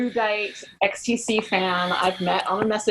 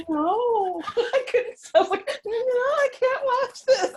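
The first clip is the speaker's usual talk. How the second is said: high laughing cry of no; laughing mock dismay. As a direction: wail it through laughter. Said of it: "And I was just like".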